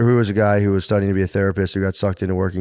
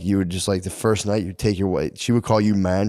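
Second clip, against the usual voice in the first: normal talking voice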